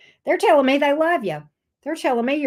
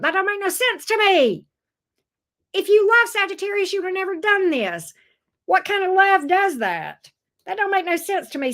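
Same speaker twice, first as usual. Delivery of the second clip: higher, indignant scolding; exasperated scolding; 7 semitones higher than usual. Real, not acted. Reader scolds the ex on Sagittarius's behalf, higher and indignant. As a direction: call out the nonsense, voice pitched higher.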